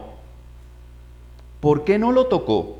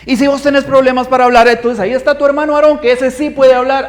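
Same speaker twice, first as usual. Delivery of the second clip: louder, higher, fast scolding; angry God; 6 semitones higher, 4 dB louder than usual. Retold or imitated. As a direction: scold the excuse-maker firmly. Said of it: "Dios se enoja y le dice". (Dios regañando a Moisés, enojado y fuerte)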